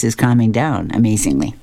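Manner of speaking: ordinary delivery, nothing marked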